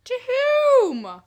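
high, loud hooted question; mock owl hoot. Co-host turns the owl's cry grammatical, mock hooting.

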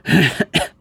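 dry cough from a worn-out voice; short raspy cough; hoarse cough. Real, not acted. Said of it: "As you can tell".

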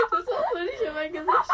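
loud, high shout through laughter; laughing protest. Real, not acted. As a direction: shout your protest while cracking up. Protests while laughing at the mask. (lachend protestieren, laut gerufen)

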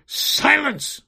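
high, loud tyrant's command; shout. A narrator's reading. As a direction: cut off the noise with a shout. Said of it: "shouted the enchanter".

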